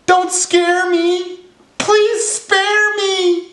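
very high frightened squeal; squeaky panic, stretching out the final pleas; panicked elephant shout. A narrator's reading. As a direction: plead in cartoon terror, high-pitched. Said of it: "shouted the elephant".

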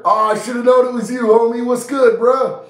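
ordinary talk

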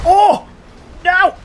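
high, loud cry of dismay; alarmed shout. Real, not acted. Player caught by the boss, alarmed high shout. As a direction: yell out as the hit lands.

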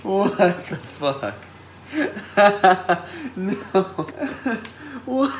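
high, squealing horrified giggle-talk; creeped out and cracking up; freaked-out laughing. Real, not acted. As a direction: laugh through the horror of it.

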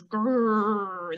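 low bear growl. Retold or imitated. Teacher showing the children what the bear says, growling. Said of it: "He goes".

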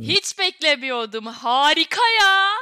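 slow, loud, amazed praise; stunned, drawn-out wow. Real, not acted. Amazed after hearing song.

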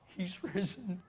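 giggling excitement. Acted. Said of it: "kind of giggling among themselves".

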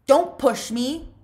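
angry shout. Acted. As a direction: yell it as a threat. Zigzag picking fight.

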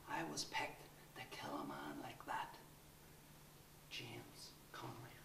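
low whisper; hushed confession. Acted.

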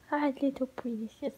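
cooing to pet. Real, not acted. Greeting the cat.

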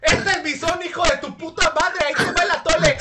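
loud furious vendor yelling; angry yelling. Retold or imitated. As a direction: yell it like a turf war. Imagining tamale vendors fighting.

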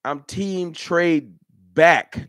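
slow, with back punched loud; drawn-out team trade back, back hit loud; stressed slogan. Shown as a demonstration. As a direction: land hard on back.

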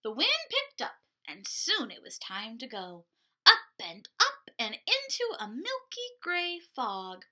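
put-on lively picture-book narration for little kids; storytime reading. A narrator's reading. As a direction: read it like a children's librarian. Picture-book read aloud to kids.

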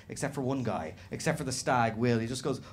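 ordinary talk

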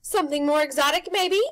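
loud, high showman pitch; pushy grinning tempter; Rictus's brash voice. A narrator's reading. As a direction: sell it like a carnival barker.

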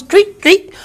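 ordinary talk, nothing marked

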